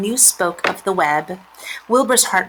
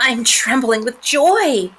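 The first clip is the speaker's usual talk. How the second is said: higher, slow, drawn-out delight; overjoyed pig; 5 semitones higher, 4 dB louder, slower than usual. A narrator's reading. Wilbur answers new spider friend, high joyful voice. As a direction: stretch out the joy as the pig.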